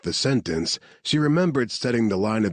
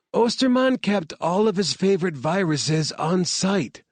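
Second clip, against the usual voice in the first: higher woman's voice, urgent warning; Claire warning; 11 semitones higher than usual. Acted. Scientist warning soldiers about biohazard, higher and urgent. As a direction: stop them going in unprotected.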